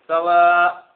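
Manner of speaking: loud, long "سواء" held as "sawaaa'", no final n; stopping without tanween. Shown as a demonstration. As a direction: stretch "سواء" and stop dead, tanween silent. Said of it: "فلا نلفظ ماذا؟ التنوين".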